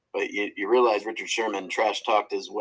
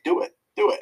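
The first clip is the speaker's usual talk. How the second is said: taunting dare; 9 semitones higher, 5 dB louder than usual. Retold or imitated. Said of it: "so he was like".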